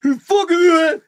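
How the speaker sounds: loud, high-pitched quarrel yell. Acted. Costumed Ken fights back at Barbie, yelling. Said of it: "it's just those guys yelling".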